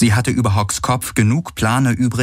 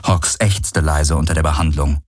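usual speaking voice

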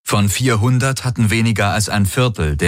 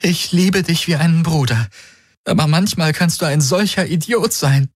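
high, emotional reproach full of affection; through tears; 10 semitones higher than usual. A narrator's reading. Kirai to her wounded brother-like friend, tearfully. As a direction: scold him lovingly while crying. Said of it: "während ihr die Tränen liefen".

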